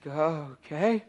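small, soft, hesitant reply; taken aback. Retold or imitated. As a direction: imitate a stunned, unsure murmur. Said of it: "And she's like".

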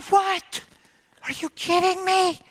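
very high, amazed disbelief; incredulous. Retold or imitated. Hunter reacting to the turkey's answer, high incredulity. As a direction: squeal it in happy disbelief.

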